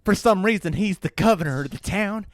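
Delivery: hammed-up Southern accent. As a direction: play an aggrieved backwoods brother with a Southern accent. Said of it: "I sound like the pervert guy and the Tiger King".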